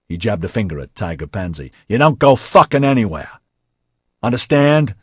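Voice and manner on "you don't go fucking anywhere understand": loud, high angry order; yelling. A narrator's reading. J C orders Tiger Pansy to stay, yelling. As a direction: shout the command. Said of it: "J C yelled".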